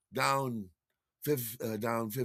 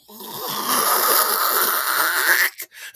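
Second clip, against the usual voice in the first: long, loud voiceless rasp; harsh drawn-out throat scrape; ripping noise; 4 semitones higher, 7 dB louder than usual. Retold or imitated. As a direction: imitate cloth tearing. Imitating the shirt tearing.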